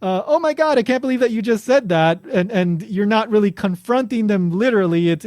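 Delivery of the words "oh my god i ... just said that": high-pitched mock-shocked friendly teasing; playful incredulous exclamation, pitched up; joking disbelief. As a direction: tease a friend as if you can't believe it. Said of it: "You're kind of joking around with them".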